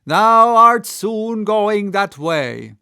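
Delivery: loud, slow, doom-laden; ominous cry. A narrator's reading. Gabriel foretelling Ahab's death, loud and slow. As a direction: drag out the prophecy. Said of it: "cried Gabriel to Ahab".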